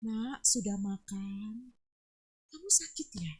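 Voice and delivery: slow, tender motherly question; gentle intonation. Shown as a demonstration. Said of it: "Intonasinya tuh lembut". (intonasi lembut keibuan)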